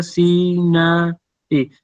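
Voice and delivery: "si, na" drawn out as separate syllables; regular syllable division. Shown as a demonstration. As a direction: sound out "si, na" with a break between them. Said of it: "en la separación regular".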